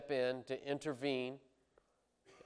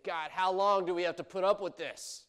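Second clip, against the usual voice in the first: loud, higher-pitched plea; crying out to God; 6 semitones higher, 6 dB louder than usual. Acted. Prophet demands an answer from God, loud exasperated cry. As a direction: call out to heaven, fed up. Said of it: "he's calling out to God".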